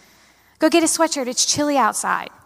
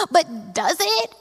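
naive question; 5 semitones higher, slower than usual. Retold or imitated. Retold naive reply to dad.

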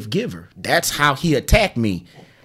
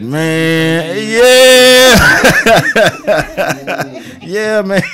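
nervous reaction; 4 semitones higher, 5 dB louder, much slower than usual. Retold or imitated. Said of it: "I'm like".

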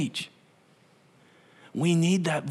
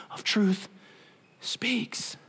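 breathy whispered word; 2 semitones higher, 5 dB quieter, much slower than usual. Acted. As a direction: whisper it gently. Voice of truth whispered.

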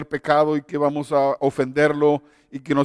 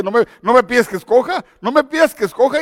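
pleading; 5 semitones higher, 4 dB louder than usual. Retold or imitated. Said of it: "él empieza a llorar y dice".

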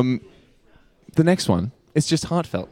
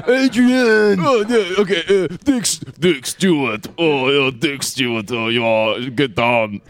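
Sly Stallone; 2 semitones higher, slower than usual. Acted. Asked for the Rambo star, Sly Stallone impression. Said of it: "Give me some Sly Stallone".

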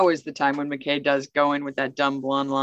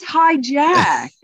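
"Hi, Jack" said higher and louder so it sounds like "hijack"; Mayborn's Hi Jack; 8 semitones higher, 7 dB louder than usual. Retold or imitated. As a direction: land "Hi, Jack" like the word "hijack". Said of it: "how he says".

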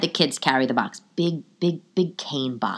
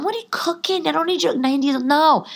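much higher, disbelieving reply; incredulous protest; 11 semitones higher than usual. Retold or imitated. Granddaughter objecting to ninety-year-old grandmother cooking, incredulous.

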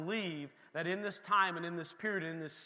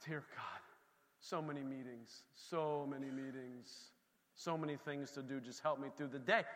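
soft, tired little prayer, much softer; weary prayer; 5 semitones lower, 8 dB quieter than usual. Retold or imitated. Believer praying through a busy day, softly and wearily. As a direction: sigh it out to God like a worn-out believer.

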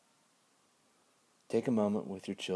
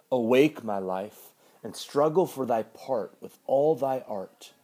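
dramatic poetry reading; poem recital; 2 semitones higher, 8 dB louder, slower than usual. Acted. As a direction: declaim the verse with feeling. Recites George Herbert poem.

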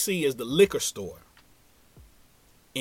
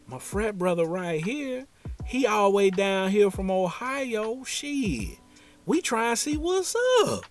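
rising, higher Southern drawl; Southern accent; 7 semitones higher than usual. Retold or imitated. Scoob introducing his frat brother to the lady, in a Southern accent. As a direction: pitch up into the thick Southern accent. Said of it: "thick Southern accent".

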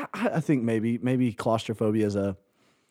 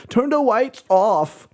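put-on high, slow insistent kid; lightly voiced demanding boy; child voice demanding; 12 semitones higher, 3 dB louder, slower than usual. Son Weston demanding darkness.

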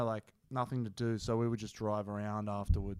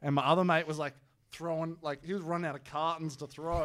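usual speaking voice

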